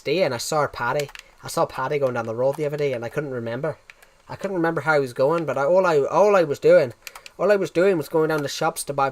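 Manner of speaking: rambling put-on Irish brogue; lilting imitation Irish, running on; Irish accent. Shown as a demonstration. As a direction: spin a yarn in an Irish accent. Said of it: "You nailed the Irish one".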